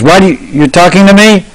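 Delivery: high-pitched indignant boy, playfully acted; mock angry. Cowherd boy arguing with an echo from a well, mock anger. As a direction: challenge the echo in pretend outrage. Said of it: "would get angry with the echo".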